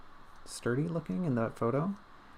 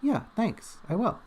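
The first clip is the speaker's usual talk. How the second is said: imitating nephew; 9 semitones higher than usual. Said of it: "And he goes".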